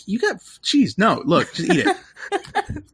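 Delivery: high giggling plea to eat the sweet; the Doctor insisting through laughter; laughing Doctor. Retold or imitated. The Doctor pushing a jelly baby, laughing. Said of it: "he's like".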